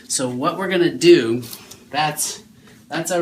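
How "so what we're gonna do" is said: ordinary delivery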